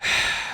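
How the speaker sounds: weary sigh. Retold or imitated. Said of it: "And you're like".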